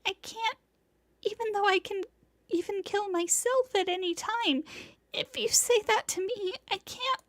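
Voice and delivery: put-on high, tearful girl's voice. Senpai crying to Tono-kun, high and tearful. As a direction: speak through tears. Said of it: "Her tears course down her face".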